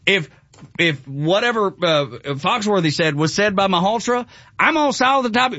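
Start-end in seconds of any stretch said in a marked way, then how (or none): 4.6-5.5 exaggerated high Southern twang; Southern accent. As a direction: imitate a folksy Southern surgeon. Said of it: "with that accent".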